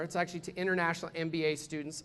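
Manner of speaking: ordinary talk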